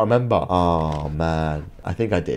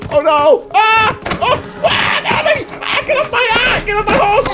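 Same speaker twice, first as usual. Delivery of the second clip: frantic yelling. Real, not acted. Attackers drag him off the horse, frantic yelling. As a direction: shout for your life at attackers.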